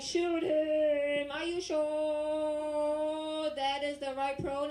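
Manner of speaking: high, loud, stretched-out mock command; general. General shouts orders to liberal troops, loud drawn-out holler. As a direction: holler it long across a battlefield. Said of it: "the general will be like".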